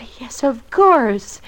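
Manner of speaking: slow, hushed whisper, caught off guard; nervous whisper. Acted. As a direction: breathe it out guiltily. Wife caught out, answers nervously.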